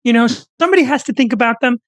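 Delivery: high, pleading lecturer voice held right through; earnestly channel Jordan Peterson defending young men; Jordan Peterson impression. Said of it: "who's better at impressions?".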